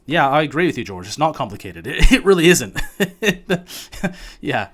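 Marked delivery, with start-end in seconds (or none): 1.9-2.8 laughing through the words, much louder and higher. Real, not acted. Host agreeing with George while laughing out loud. 2.8-4.3 high, drawn-out laugh; real laugh. Real, not acted. Host laughing after agreeing with George, long laugh.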